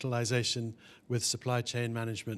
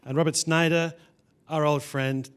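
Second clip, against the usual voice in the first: usual speaking voice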